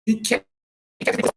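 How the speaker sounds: usual speaking voice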